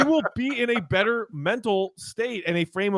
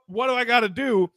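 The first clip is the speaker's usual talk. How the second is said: a touch of exasperation, less pitched up; milder mock plea; 6 semitones higher than usual. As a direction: repeat the question wearily. Retold frustrated player repeating.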